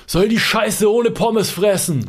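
high, drawn-out imitation bellow; retold yelling back. Colleague roaring back about the fries complaint, retold yell. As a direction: bellow back as the second worker. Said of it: "brüllt der andere zurück".

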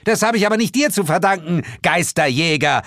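fast, high, loud tirade; shrieking woman's indignant outburst; angry yelling. A narrator's reading. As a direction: throw a scornful accusation. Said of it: "schrie sie".

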